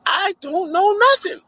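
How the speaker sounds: high, pleading denial; scared denial. Retold or imitated. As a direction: beg them to believe you. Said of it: "I said".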